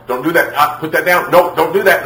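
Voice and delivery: high, urgent string of commands; imitated mom scolding. Mom repeatedly telling her child to stop, imitated mom scolding.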